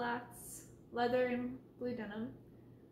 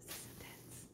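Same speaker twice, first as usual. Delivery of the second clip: low hushed whisper; 16 dB quieter, faster than usual. Real, not acted. Tense moment watching the cat, whispered.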